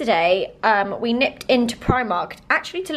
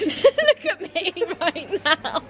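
normal talking voice

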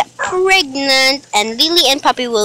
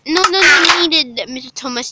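higher, louder quick protest; pretend insistent refusal; 4 semitones higher, 4 dB louder than usual. Child refuses money back from babysitter, playful insistent refusal. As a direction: wave the offer away eagerly.

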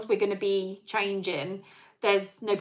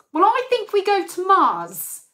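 much higher, louder deadpan claim; brother-in-law; 10 semitones higher, 6 dB louder than usual. Retold or imitated. Brother-in-law at the table claims Mars, higher and louder. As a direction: announce it like it's obvious. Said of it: "my brother-in-law ... says".

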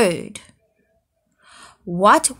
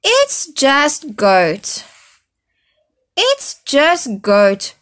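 slow, louder weary groan; 5 semitones higher, 6 dB louder, slower than usual. Acted. Magpie groaning about the snoring goat, slow and weary. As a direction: groan it, worn out. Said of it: "groaned Magpie".

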